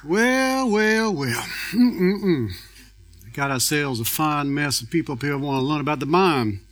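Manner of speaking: high, loud, exaggerated Southern drawl; put-on Southern drawl. Speaker greets the audience in a hammed-up Southern accent. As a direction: ham up a thick Southern accent to tease the crowd. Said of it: "that's not the, the actual accent".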